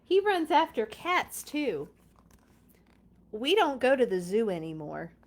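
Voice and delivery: lively storybook read-aloud; reading to children. Acted. Reading Clifford aloud to viewers, bright storybook voice. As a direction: read it like story time for little kids. Reads picture book aloud.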